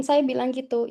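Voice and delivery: ordinary delivery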